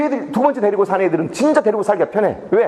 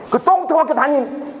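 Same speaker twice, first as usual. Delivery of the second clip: retold put-down, higher and drawn out; mock contempt; 7 semitones higher, slower than usual. Policeman dismisses the teacher of a lousy school, mock contempt. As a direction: imitate a scornful policeman, stretching it slowly.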